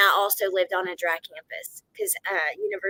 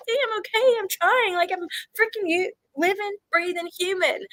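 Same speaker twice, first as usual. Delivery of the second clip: much higher, squeaky mock plea; mock pleading reply; 10 semitones higher than usual. Streamer answering commenters with a much higher, mock pleading voice. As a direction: pitch it way up like a pleading cartoon. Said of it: "Or it's like".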